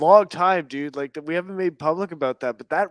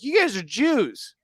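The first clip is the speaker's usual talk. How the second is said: slow, higher blunt call-out; slow, higher blunt call-out to the men; blunt accusation; 6 semitones higher, slower than usual. Retold or imitated. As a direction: say it flat out, no beating around. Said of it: "That's exactly how he said it".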